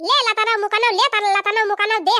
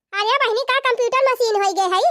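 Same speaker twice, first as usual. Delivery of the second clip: ordinary talk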